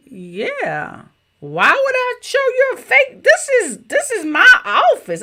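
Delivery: mock-incredulous, as if the question were silly; amused disbelief. Telling viewers how she answered the interviewer, high and amused. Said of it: "I was like".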